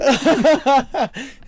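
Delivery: real cackling laugh; laughing hard at the friend's impression; high loud laugh. Real, not acted.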